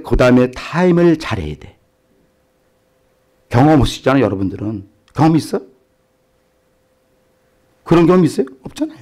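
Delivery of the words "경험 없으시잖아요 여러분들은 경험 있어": ordinary talk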